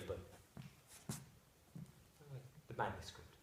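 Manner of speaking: very low, soft and sorrowful; subdued dismay. Acted. Husband grieves for his poor friend, subdued dismay. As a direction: sink to a troubled murmur.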